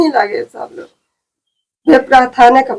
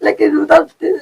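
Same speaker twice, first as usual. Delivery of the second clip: crying protest; 7 semitones higher than usual. Real, not acted. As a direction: cry out the correction. Woman upset correcting the reporter.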